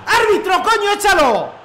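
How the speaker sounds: outraged fan shouting at the screen; angry yell at the referee. Real, not acted. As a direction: bark it at the ref, furious. Yelling at referee in game.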